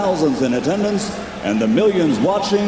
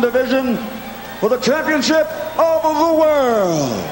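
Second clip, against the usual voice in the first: lucha libre announcer. Acted. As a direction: stretch every name like a big arena intro. Ring announcer introductions.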